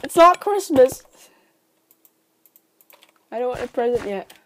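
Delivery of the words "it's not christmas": loud protest. Real, not acted. Refusing friend's present.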